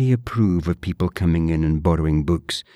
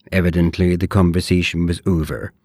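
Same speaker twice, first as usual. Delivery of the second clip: usual speaking voice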